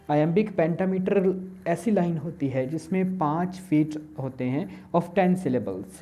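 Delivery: ordinary talk, nothing marked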